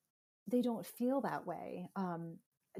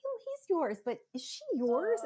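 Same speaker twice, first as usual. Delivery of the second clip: nosy stranger; 12 semitones higher, 6 dB louder than usual. Retold or imitated. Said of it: "people will be like".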